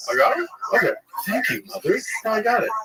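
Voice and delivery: high-pitched mock-grateful son; sarcastic gratitude. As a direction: thank mother with exaggerated, high-voiced sarcasm. Sarcastic reply to cheering mom.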